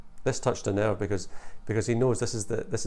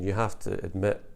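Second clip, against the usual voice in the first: usual speaking voice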